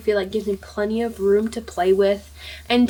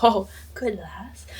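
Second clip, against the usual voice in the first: whispered, slow Scottish lilt on good lass; hushed Welsh-Scottish good lass; Scottish accent; slower than usual. Retold or imitated. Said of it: "like, a Welsh Scottish accent".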